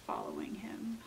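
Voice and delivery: hushed, creepy storytelling whisper; spooky whisper. A narrator's reading. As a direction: drop to a breathy whisper.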